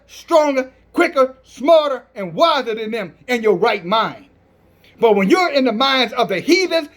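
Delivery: ordinary talk, nothing marked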